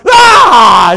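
loud, high yell of fright; startled scream in return; acted scream back. Trooper screams back at the frightened wife, acted scream. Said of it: "He screamed back".

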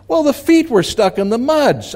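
put-on high-pitched boyish complaint; acted protest. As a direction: play a kid stuck and protesting. Boy calls up from the ditch.

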